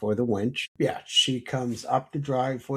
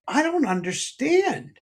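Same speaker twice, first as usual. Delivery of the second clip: imitating upset wife; 14 semitones higher than usual. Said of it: "and she was like".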